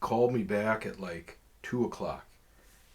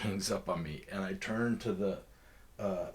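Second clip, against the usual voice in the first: normal talking voice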